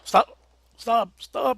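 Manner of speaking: loud urgent yell; shouting. Real, not acted. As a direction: yell at them to stop. Player yelling to halt fire.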